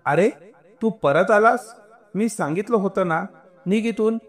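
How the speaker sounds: high-pitched, annoyed scolding; irritated shout. A narrator's reading. Guard sending away a boy who came back, irritated shout. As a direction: bark at him crossly, pitched well up. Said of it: "तो चिडून ओरडला".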